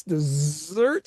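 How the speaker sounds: "Dessert" with a long, buzzing stretched S; drawn-out S's. Shown as a demonstration. Storyteller showing the huge dessert table's size, "Dessert" with drawn-out S's. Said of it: "spelled with ten S's".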